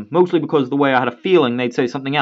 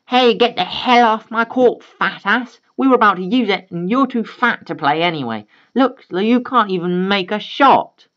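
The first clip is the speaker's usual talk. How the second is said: put-on bratty kid taunt; 7 semitones higher than usual. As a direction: sneer it at her like a bratty teen. Kids taunting daughter on court.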